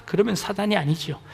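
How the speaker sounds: usual speaking voice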